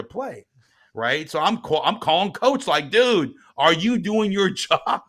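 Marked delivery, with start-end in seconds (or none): 2.9-5.0 high, drawn-out incredulous demand; exasperated, stretching out the question; ticked-off challenge. Retold or imitated. Dad calling the coach to account, ticked off. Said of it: "I'm feeling ticked off".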